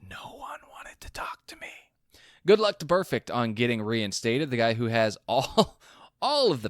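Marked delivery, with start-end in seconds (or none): 0.0-1.7 breathy whisper reading a quote. Retold or imitated. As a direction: whisper it like a secret. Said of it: "Vontaze whispering in my ear".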